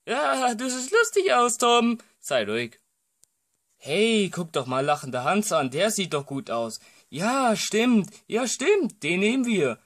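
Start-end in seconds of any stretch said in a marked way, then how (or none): 0.1-2.0 high, mocking tease; higher teasing voice. Friend mocking Tom in his mask, high teasing. 7.1-9.8 much higher, excited yes-saying; high eager agreement. Acted. Friend eagerly choosing Hans as the disguise, excited.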